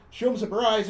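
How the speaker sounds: ordinary delivery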